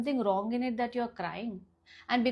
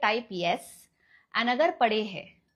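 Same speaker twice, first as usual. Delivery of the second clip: normal talking voice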